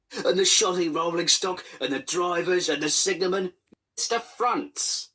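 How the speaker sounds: high, pleading whine; grovelling. Acted. Controller begging the inspector, high pathetic pleading. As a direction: beg pathetically on your knees.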